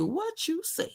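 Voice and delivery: high, squeaky what-you-say; shocked. Real, not acted. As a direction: gasp it out in disbelief. Host's shocked reaction to Tia.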